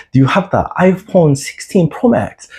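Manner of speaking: playing the viral Hongdae guy asking a stranger; Hongdae guy impression. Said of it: "too much Hongdae guy voice".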